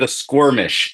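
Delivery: "squirmish" said with a kw after the s; mispronounced word. Retold or imitated. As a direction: say "squirmish" as skwor-mish. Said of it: "I saw called it".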